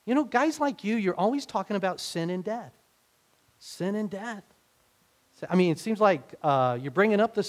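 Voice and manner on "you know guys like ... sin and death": high, teasing voice of a skeptic; mocking skeptic. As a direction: needle the preacher in a high mocking tone. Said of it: "they'll say it like this".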